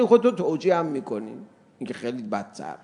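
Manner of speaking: ordinary talk, nothing marked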